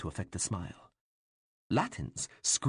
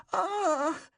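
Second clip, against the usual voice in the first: high, loud startled cry; a boy watching his towns flooded; sharp shrill Ah of dismay; boyish cry of alarm; 5 dB louder than usual. A narrator's reading. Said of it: "Latins cried".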